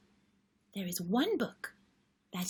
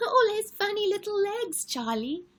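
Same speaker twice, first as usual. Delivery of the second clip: laughing; 12 semitones higher, 4 dB louder than usual. Acted. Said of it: "And Lola's just laughing away".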